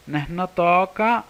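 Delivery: slow, high, loud Neh notoka; Nahuatl phrase. Shown as a demonstration.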